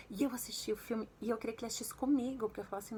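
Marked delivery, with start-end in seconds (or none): none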